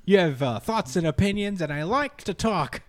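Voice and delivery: high comic character voice; goofy put-on suitor's voice; Grant Morrison. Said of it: "that's gonna be his voice".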